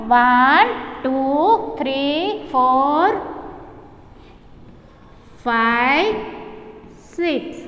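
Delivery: slow count with long gaps; counting aloud. Shown as a demonstration. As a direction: count one by one, stretching each number. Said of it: "गिनो". (एक-एक अंक रुक-रुककर, खींचकर गिनना)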